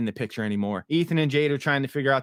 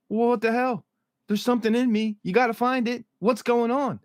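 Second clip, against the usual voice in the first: higher, pleading imitation of a frantic woman; imitating a frantic patient, much higher; mock distress; 10 semitones higher than usual. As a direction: pitch up and push the panic, a bit hammed. Fatima upset, acted quote.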